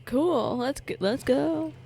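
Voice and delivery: usual speaking voice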